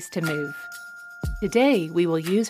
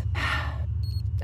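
soft unvoiced sigh out; long sigh out; 6 dB quieter than usual. Shown as a demonstration. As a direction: let the breath out slowly. Breathing exercise sigh.

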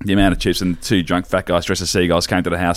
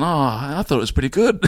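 put-on awkward boyfriend; 6 semitones higher than usual. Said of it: "just going".

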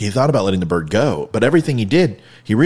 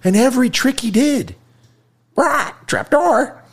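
very high squawking parrot voice; 13 semitones higher, 4 dB louder, slower than usual. Retold or imitated. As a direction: screech it out like a parrot. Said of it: "I can't do it the whole time".

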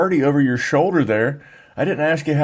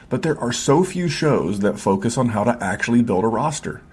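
upbeat promo voice-over read; 4 semitones lower than usual. Acted. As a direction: sell the Patreon like a radio spot. Podcast ad read over music.

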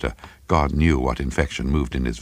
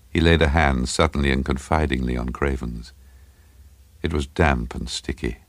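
ordinary delivery, nothing marked

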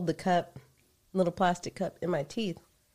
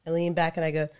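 ordinary delivery

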